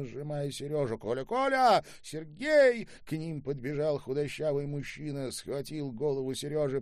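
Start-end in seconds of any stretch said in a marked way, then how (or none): 1.0-2.9 very high, loud, overwhelmed calling; woman crying out. A narrator's reading. Woman calls her husband to their returned son, crying out. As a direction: call out frantically, pitch way up. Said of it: "закричала женщина".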